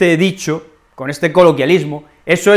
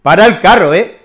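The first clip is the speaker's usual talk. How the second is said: loud "ey, ey" to cut someone off, then a firm hush; calling someone to stop; 7 dB louder than usual. Shown as a demonstration. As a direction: rein in someone who's getting worked up, calling it out loud. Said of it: "En esas situaciones podemos decir". (llamando a alguien a calmarse, en voz alta y firme)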